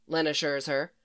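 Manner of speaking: ordinary delivery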